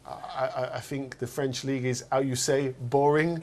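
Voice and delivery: mock French accent, half laughing; imitating a footballer's French accent. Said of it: "in a French accent".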